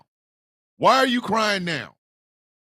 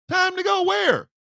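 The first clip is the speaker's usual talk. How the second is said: startled wife; 9 semitones higher than usual. Acted.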